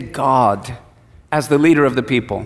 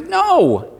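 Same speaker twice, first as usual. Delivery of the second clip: firm refusal; 12 semitones higher than usual. Retold or imitated. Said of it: "And God says".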